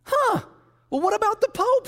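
high, sceptical "huh" and question; grudging surprise. Acted. Beautician, caught off guard, asks about the Pope, high and sceptical. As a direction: sound thrown off, voice way up.

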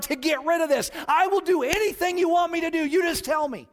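high, loud angry rant at God; letting God have it. Retold or imitated. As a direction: shout out your frustration, building to a desperate demand. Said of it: "I just let God have it".

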